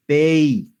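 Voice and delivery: clear, loud model syllable pei; drill syllable pei. Shown as a demonstration. Teacher modelling pei for students, loud and clear. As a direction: say pei out loud so the mouth closes. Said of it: "Ahora pronuncia pei".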